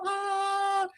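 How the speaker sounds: high squealing "Ah!" of thrill; excited gasp. Retold or imitated. New car owner squeals with joy, high excited gasp. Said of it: "You get into the car".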